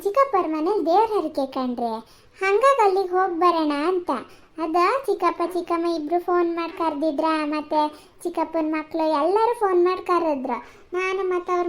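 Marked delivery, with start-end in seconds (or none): none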